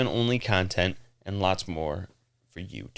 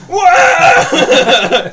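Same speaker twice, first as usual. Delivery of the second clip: laughing shout; 15 dB louder than usual. Real, not acted.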